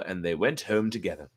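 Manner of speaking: ordinary delivery, nothing marked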